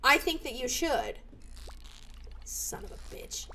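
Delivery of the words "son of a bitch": unvoiced, very soft cursing; muttered curse. Real, not acted. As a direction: swear under your breath. Cursing at fishing, first take under breath.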